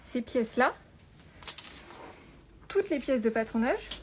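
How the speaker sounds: ordinary talk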